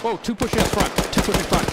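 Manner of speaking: high urgent shout, enemies rushing; alarmed callout. Real, not acted. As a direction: yell the warning fast and high.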